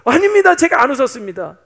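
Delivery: high, loud, hasty protest; acted panicky denial; flustered denial. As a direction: deny it outright, startled and loud. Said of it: "손살에 치면서 잡아떼죠".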